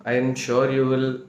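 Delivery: usual speaking voice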